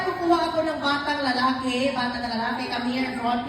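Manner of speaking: normal talking voice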